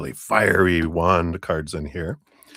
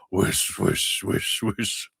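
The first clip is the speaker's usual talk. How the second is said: breathy whoosh like gusting wind; whooshing wind noise; much slower than usual. Retold or imitated. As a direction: blow the words out like wind. Mimicking wind for weather.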